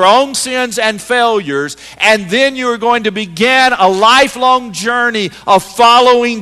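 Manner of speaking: loud, high, driving preacher build; preaching at full force. Acted. Pastor rallying the congregation, preaching at full force. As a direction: preach it out full voice, rising to a climax.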